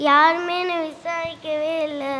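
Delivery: whiny, self-pitying lament; acted crying. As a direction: wail that nobody cares. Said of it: "எதுக்கு அழுதுட்டே இருக்க நீ".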